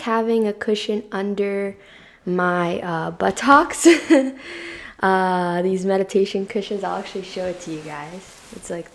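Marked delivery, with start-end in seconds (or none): 3.9-4.9 short, higher-pitched giggle; embarrassed chuckle. Real, not acted. Host tickled by saying buttocks, short giggle. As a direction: let out a quick amused laugh.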